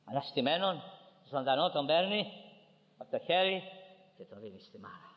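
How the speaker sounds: ordinary talk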